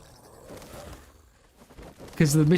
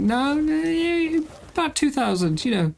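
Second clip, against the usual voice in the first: high voice; 10 semitones higher than usual. Retold or imitated. Inner voice guessing someone's age.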